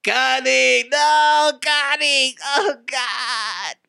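high monotone robotic wail; wailing robot meter maid. Acted. Robot meter maid watching a robot driver get shot, wailing. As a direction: cry out on one long high note, mechanically. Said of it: "a robotic meter maid".

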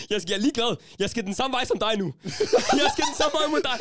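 put-on retelling chasing the scared boy, laughing; laughing defiance. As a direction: laugh through a mock challenge.

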